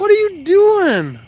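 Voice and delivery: slow, loud, high disbelieving scold; real scolding shout at a buddy; exasperated yell. Real, not acted. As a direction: drag it out in disbelief at him. Scolding friend who lost fish.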